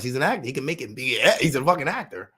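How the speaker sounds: ordinary delivery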